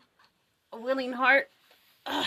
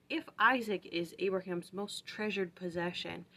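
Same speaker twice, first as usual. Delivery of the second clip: ordinary talk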